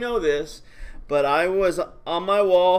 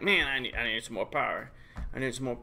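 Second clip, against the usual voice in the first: mopey Peaches; 6 semitones lower, 7 dB quieter, much faster than usual. Retold or imitated. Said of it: "she would say it like this".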